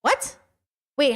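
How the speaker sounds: ordinary talk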